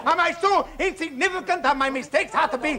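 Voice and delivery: shouting high and loud through bitter laughter; a wounded father's furious outburst. Acted. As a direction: scream it at the whole family, half laughing in rage. Furious family quarrel at table.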